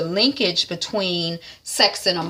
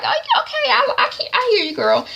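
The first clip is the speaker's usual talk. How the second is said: high excited agreement. Retold or imitated. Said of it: "so I was like".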